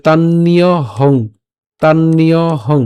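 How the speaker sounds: slow "তান্নীয় হং" as tan-niyo-hong, held vowels; model pronunciation. Shown as a demonstration. Teacher shows how we will say it, slow drawn-out demo. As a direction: stretch "তান্নীয় হং" evenly, doubled n. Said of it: "আমরা উচ্চারণটা করবো এভাবেই".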